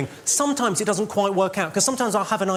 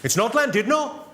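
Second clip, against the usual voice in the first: a hammed-up Welsh heckler correcting him, a touch higher; angry Welsh heckler; 5 semitones higher than usual. Welsh local in the front row corrects the comic, mock indignant Welsh. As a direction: play the cross Welsh local, lightly voiced. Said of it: "face like fucking thunder, went".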